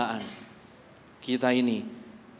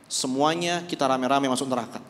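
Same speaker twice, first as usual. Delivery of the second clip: ordinary talk, nothing marked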